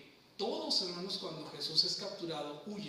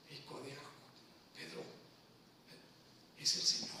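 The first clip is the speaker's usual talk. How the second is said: whispered, much softer reveal; 4 semitones lower, 6 dB quieter than usual. Retold or imitated. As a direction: breathe the secret to Peter. Juan avisa a Pedro en secreto. (susurro: es el Señor)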